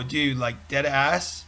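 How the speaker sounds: ordinary delivery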